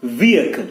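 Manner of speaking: "Vehicle" as VEE-uh-kul; silent H. Shown as a demonstration. Teacher repeating what he said, silent H. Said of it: "not vehicle".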